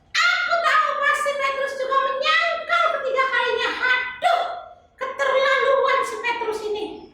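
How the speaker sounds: put-on high, sing-song little girl voice; chirpy kid puppet answering the pastor; high child puppet voice. Tiur predicts third denial.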